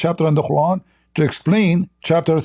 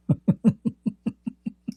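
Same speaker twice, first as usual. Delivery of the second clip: breathy scornful laugh; mocking laugh; 7 semitones higher, 6 dB quieter than usual. Real, not acted. Laughs at the dome claim.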